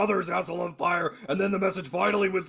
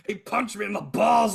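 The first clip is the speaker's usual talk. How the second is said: ordinary talk, nothing marked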